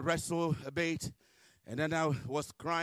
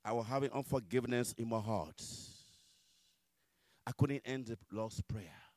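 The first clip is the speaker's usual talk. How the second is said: normal talking voice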